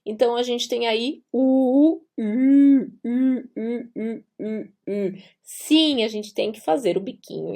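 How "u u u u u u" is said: "U" with lips rounded as U while voicing I; rounded ü sound. Shown as a demonstration. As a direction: pucker for U, say I. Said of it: "boca do U e vai pronunciar I".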